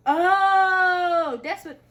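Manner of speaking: a sudden loud high gasp of an oh; surprised oh. Real, not acted. Opening a gift she can't open, loud surprised oh. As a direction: caught off guard by the gift, crying out.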